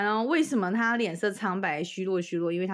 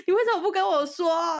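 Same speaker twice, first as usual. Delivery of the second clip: high, loud protest; husband scolding his wife; shocked reproach; 10 semitones higher, 6 dB louder than usual. Retold or imitated.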